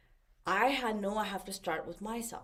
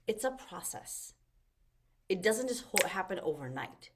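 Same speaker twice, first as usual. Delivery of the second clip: usual speaking voice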